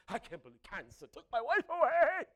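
loud, slow mock lament over a lost wife; mock wail. Preacher mocking a husband who blames cancer, loud slow wail. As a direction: wail it like a caricatured grieving husband.

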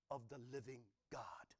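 hushed reverent whisper. Real, not acted. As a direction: breathe the end of the verse. Reading scripture softly.

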